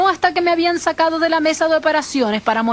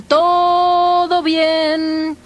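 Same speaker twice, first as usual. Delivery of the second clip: extremely drawn-out watch cry; lookout's call from the mast; much slower than usual. Retold or imitated. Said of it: "desde el mástil principal". (grito muy alargado desde el mástil)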